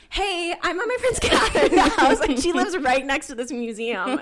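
high, loud chirpy voice; ditzy oversharing gush; mock oversharer. Mocking oversharing friend.

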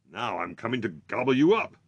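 loud troll threat; menacing troll. A narrator's reading. Troll threatening to eat the goat, loud and menacing. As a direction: boom it out like a hungry troll. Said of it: "roared the troll".

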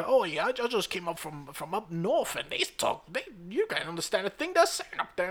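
high put-on accent; mock British barmaid. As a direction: chatter it in a high, hammed-up regional British voice. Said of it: "she was like".

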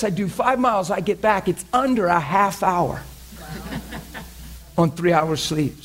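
ordinary talk

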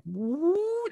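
a high whoop climbing up; rising woo. Retold or imitated. Describing a rage spiral rising, a high whoop. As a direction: let the sound climb like anger peaking.

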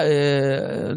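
ordinary delivery

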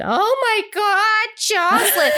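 high, drawn-out imitated gasping delight; mock amazement. Whole family revealing the bike to Jocelyn, hammed-up amazement.

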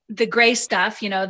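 ordinary delivery

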